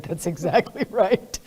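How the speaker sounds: retelling his reply through laughter; amused quote broken by a laugh. Retold or imitated. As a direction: laugh the words out, imitating him. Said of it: "he burst out laughing".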